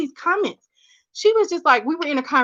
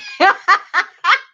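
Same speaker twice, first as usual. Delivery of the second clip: burst of laughter; 8 semitones higher, 6 dB louder than usual. Real, not acted. Laughing at the drug deal joke.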